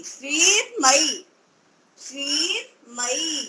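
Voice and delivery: श्रीमयी stretched out slowly, with the र sounded after श्री; teacher drawing out श्रीमयी syllable by syllable; slow syllable-by-syllable demo. Shown as a demonstration. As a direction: model श्रीमयी for a learner, one syllable at a time. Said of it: "श्री के बाद र बोलेंगे".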